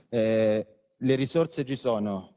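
ordinary talk, nothing marked

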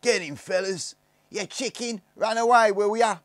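high, loud jeering yells; rough kid shouting; shouting boys. A narrator's reading. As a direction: taunt him at full voice as you chase. Gang chasing Barney.

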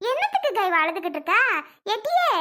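quick scolding, ending on a high call. Acted. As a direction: tell them off fast, pitch jumping up. Mother scolds, cuts into pleading.

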